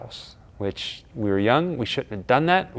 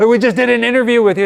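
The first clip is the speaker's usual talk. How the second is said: higher, loud and fast, panicked; flustered fan reminding the star; flustered explaining; 11 semitones higher, 6 dB louder, faster than usual. Retold or imitated. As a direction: rush it out to explain. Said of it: "I'm like".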